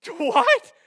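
high, loud yelp of disbelief; shocked disbelief. Acted. Preacher reacting to David's bold threat, shocked disbelief.

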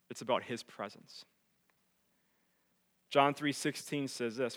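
ordinary delivery

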